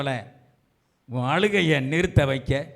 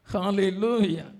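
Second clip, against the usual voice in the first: ordinary delivery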